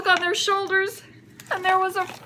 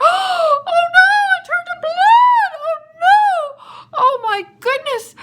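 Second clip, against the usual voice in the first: very high, loud mock-horrified wail; panicked wailing; 11 semitones higher, 8 dB louder, slower than usual. Egyptian sees the grabbed water turn to blood, panicked wail. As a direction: shriek in storybook panic.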